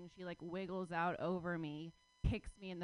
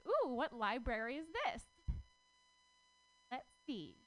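curious high voice; 6 semitones higher than usual. Retold or imitated. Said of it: "I was like".

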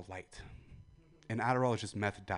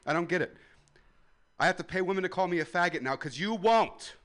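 normal talking voice

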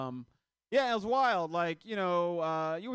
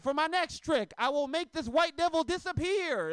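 mock magician kid voice; 10 semitones higher, 5 dB louder than usual.

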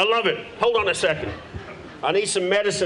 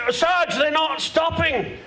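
mock police shout; 9 semitones higher, slower than usual. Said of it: "They're like".

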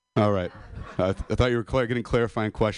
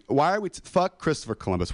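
ordinary talk, nothing marked

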